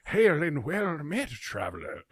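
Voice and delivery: put-on slow, high old man greeting; creaky old traveler's greeting, slowly. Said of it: "I do wanna hear the old man voice".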